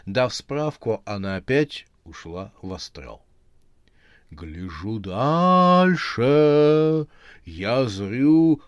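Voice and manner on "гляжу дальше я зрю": slow, louder trance intoning; measured tone. Acted. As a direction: chant it, deliberate and even. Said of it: "размеренным тоном".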